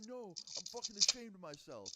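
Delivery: high, loud upset wail of a confession; imitated tearful shame. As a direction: imitate a boy about to cry from shame. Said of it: "And he starts crying again".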